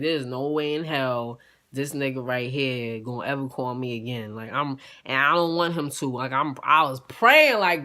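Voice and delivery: ordinary talk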